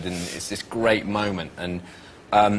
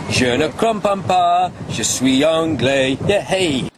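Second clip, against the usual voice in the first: put-on English-accented French; 8 semitones higher, 4 dB louder, slower than usual. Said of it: "I have the magic phrase".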